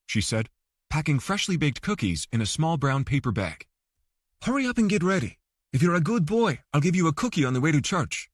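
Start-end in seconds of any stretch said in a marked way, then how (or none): 4.4-8.3 put-on higher, playful wife as if to a child; coaxing wife. A narrator's reading. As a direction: coax him like a little boy.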